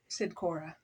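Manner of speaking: ordinary delivery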